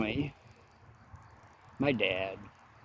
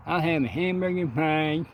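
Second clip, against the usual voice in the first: imitating a cleft lip; 4 semitones higher, 5 dB louder than usual. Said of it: "Henry also speaks with a hair lip".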